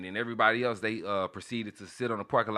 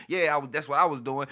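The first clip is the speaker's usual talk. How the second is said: ordinary delivery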